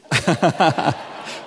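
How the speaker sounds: a short stunned laugh at what he just heard; baffled laugh. Retold or imitated. Pastor reacting to the stranger's odd answer with a baffled laugh. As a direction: laugh like you can't believe your ears.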